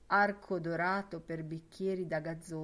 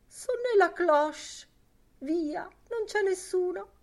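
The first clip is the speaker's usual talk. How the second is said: high, louder coaxing voice; coaxing Lenehan; 12 semitones higher, 6 dB louder than usual. Acted. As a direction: tease her to go on. Lenehan still coaxing Miss Douce.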